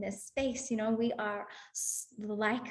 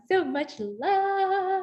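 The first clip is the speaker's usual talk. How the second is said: gushing love; 10 semitones higher, 7 dB louder, much slower than usual. Real, not acted. Affirmation circle, emotional peak.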